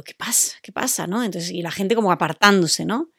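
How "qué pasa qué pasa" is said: breathy, hushed what's going on; near-whispered confused question; hushed puzzled question. Retold or imitated. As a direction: ask it under your breath, puzzled. Hearing people scream, confused.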